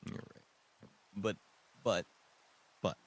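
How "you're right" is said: soft concession. Real, not acted.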